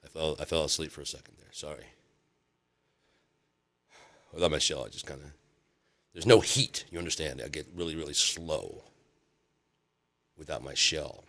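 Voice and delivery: soft, drowsy turtle voice; nodding off. Retold or imitated. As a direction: mumble like a sleepy old turtle. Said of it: "this turtle".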